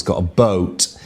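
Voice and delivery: usual speaking voice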